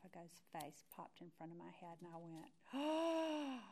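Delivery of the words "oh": sharp imitated gasp into a high "oh"; startled, acted-out intake of breath; gasp of realisation. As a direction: gasp as if it suddenly hits you. Reenacts sudden shock of realisation.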